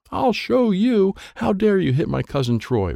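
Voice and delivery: yelling. Acted. Mary yells at the helicopters for hitting Troy. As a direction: shout down at the attackers. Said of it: "Mary is yelling at the helicopters".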